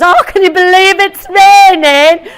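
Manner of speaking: high, loud mock whine about the rain; moaning Brit. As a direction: squeal it like an outraged Brit. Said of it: "everyone goes".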